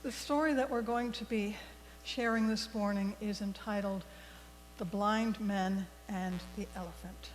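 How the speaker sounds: ordinary delivery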